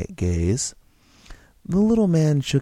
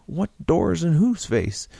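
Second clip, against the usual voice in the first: higher, mocking disbelief; scoffing; 6 semitones higher than usual. George scoffs at the stranger's offer, higher and mocking. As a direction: sneer at the idea. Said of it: "George scoffed".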